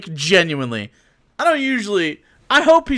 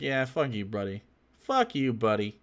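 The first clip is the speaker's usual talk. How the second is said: muttered retort; 7 semitones lower, 11 dB quieter than usual. Retold or imitated. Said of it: "then it's like".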